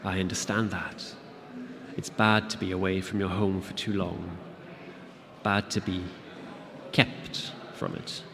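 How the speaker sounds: quiet creature voice, almost whispered at the end; soft voice. Acted. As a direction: speak gently, catching on the last words. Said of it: "The voice was soft".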